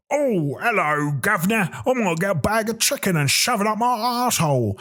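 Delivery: high, singsong mock English accent; second English accent. Comedian mimicking yet another English town, lilting English accent. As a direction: lilt it like a caricature English local. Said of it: "you go to another place".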